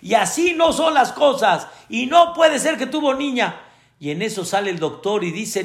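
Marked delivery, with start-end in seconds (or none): 0.0-3.6 high, mock indignant protest; the father insists it cannot be, pitched up; father yelling in protest. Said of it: "y empezó a gritar".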